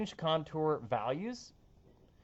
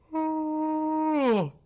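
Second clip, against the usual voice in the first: big sigh; 8 dB louder than usual. Acted. Big sigh after weather rant.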